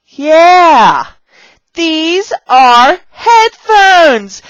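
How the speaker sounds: high, loud, very slow words; exaggerated teacher enthusiasm. Shown as a demonstration. Teacher showing beginners headphones, loud slow excited voice. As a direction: belt out each word for beginners. Demonstrating a prop lesson for beginners.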